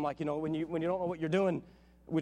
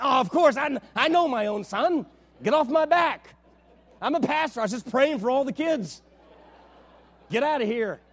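mock defensive bluster; 4 semitones higher, 6 dB louder than usual. Defensive reply to the doctor.

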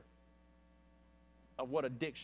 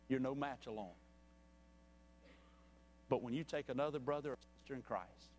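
altar call. Acted. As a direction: speak gently to each listener, reaching out. Soft altar call appeal.

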